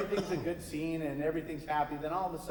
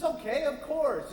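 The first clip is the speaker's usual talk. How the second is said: witch agreeing; 10 semitones higher than usual. Acted.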